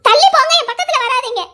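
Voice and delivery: high, loud order to back off; angry shout. Acted. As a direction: keep him away with a furious shout. Wife ordering husband away. (விலகிப் போ என்று கோபக் கத்தல்)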